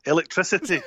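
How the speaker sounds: "Electricity" in a natural Glasgow accent; Scottish pronunciation. Shown as a demonstration. Scot showing the word his friend laughed at, natural Scottish accent. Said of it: "one word that used to get me to repeat".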